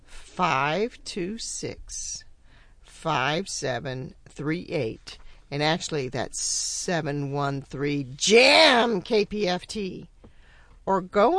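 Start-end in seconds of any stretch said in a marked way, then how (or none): 0.1-5.2 slow, careful phone number; slow dictation. Shown as a demonstration. 6.4-10.1 slow, careful phone number; slow dictation. Shown as a demonstration.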